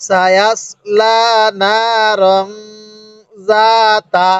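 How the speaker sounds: slow, syllable-by-syllable reading with held vowels; drawn-out teaching recitation; model Quran recitation. Shown as a demonstration. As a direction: stretch লা, না and যা, hold the nasal end of রং. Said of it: "কিভাবে পড়ব?".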